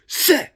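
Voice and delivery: sudden high, loud bullet hiss; explosive little whizz; imitated bullet whiz. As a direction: mimic a bullet zipping past the ear. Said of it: "The first one whizzed".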